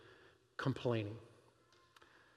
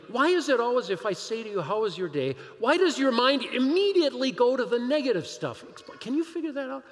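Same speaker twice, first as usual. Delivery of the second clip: exasperated; 9 semitones higher, 7 dB louder than usual. Acted. Exasperated preaching about negativity.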